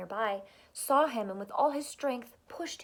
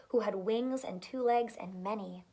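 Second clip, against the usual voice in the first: ordinary delivery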